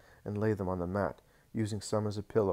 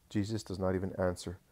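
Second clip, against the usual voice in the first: plain reading voice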